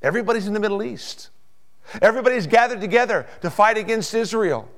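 high, loud, urgent proclamation; full-force preaching. Real, not acted. Preacher proclaiming Christ's return in glory, full-force preaching. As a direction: build to a ringing pulpit climax.

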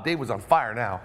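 ordinary delivery

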